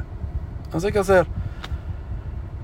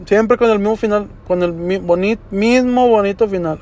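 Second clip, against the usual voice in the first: ordinary talk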